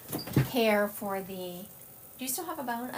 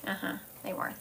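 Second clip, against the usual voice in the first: ordinary delivery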